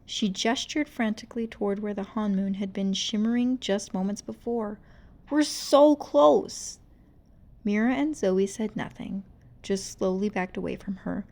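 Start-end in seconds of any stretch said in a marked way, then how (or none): 5.3-6.8 desperate. Acted. Rumi pleading frantically.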